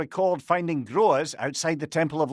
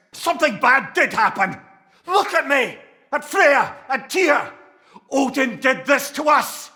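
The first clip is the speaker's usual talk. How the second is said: higher, louder furious accusation; shouting in bitter accusation; angry shout; 10 semitones higher, 5 dB louder than usual. Acted. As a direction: hurl the blame at them. Accusing confrontation over Odin.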